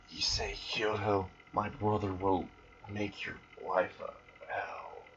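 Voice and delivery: fading whispered curse; dying whisper. Acted. Rias's dying last words.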